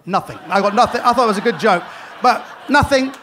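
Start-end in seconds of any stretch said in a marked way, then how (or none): none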